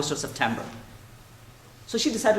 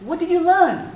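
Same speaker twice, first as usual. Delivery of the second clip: higher, louder eager question; eager papa; 12 semitones higher, 8 dB louder than usual. Retold or imitated. Father encouraging his daughter about continents, eager. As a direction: ask like an enthusiastic father.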